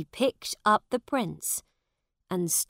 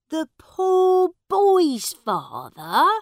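surprised; 11 semitones higher, 12 dB louder than usual. Acted. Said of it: "He was very surprised".

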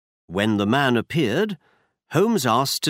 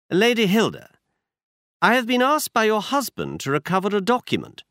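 brisk, higher-pitched detective voice; Holmes voiced higher; 10 semitones higher than usual. A narrator's reading. Holmes tells Watson where they go, higher detective voice. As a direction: voice Holmes up high, assured. Holmes answers Lady Hilda.

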